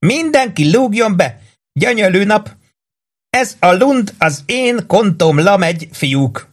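high, exuberant barkeeper's cry; beaming welcome. A narrator's reading. Charlie welcomes the boys on payday, beaming. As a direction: draw it out high and jubilantly. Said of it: "sugárzott Charlie".

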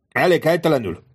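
high, light teasing tone; obligingly joking. Acted. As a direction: tease him politely. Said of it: "lekötelezően tréfálkozó hangon". (szívélyesen tréfálkozó, magas hangon)

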